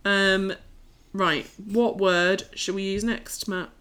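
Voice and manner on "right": normal talking voice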